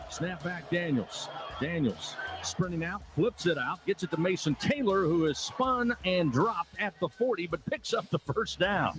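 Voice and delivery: quick excited radio play-by-play; play-by-play call. Real, not acted. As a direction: call the snap live.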